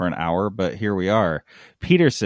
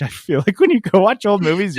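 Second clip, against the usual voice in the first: laughing; 9 semitones higher, 6 dB louder than usual. Real, not acted. Laughing into a remark.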